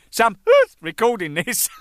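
loud, high cry broken by laughter; high, loud and laughing; laughing exclaim. Retold or imitated. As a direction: catch him out mid-laugh. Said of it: "he's going".

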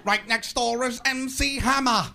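hammed-up announcer impression breaking into laughter; Robin Leach. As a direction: gush like a showy host cracking up. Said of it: "Robin Leach isn't laughing when he says".

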